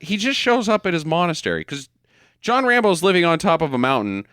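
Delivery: normal talking voice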